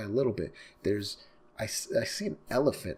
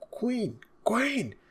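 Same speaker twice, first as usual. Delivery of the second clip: high hushed whisper; urgent whisper; 4 dB louder than usual. Acted. Underling whispering urgently to Queen, hushed and high. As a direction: hiss a warning to the boss.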